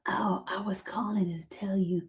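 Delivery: soft, low whisper on the phone. Real, not acted. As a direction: whisper back to match the other caller. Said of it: "why am I whispering?".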